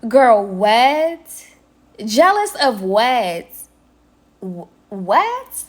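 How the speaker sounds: put-on loud, incredulous girl what; disbelieving, drawn-out jealous of what; stunned confusion; baffled. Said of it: "I was like".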